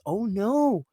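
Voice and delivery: lightly voiced fake alarm over empty cinemas; mock panicked quote. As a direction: parody the dismayed filmmakers. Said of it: "now they realize".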